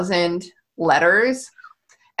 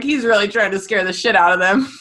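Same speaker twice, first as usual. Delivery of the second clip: usual speaking voice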